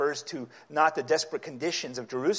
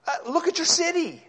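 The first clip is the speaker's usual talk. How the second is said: a much higher, louder cry; 14 semitones higher, 5 dB louder than usual. Acted. Preacher as Isaiah pleading over the city, high loud cry.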